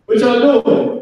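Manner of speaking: scolding parent. Acted. Parent calling to noisy kids.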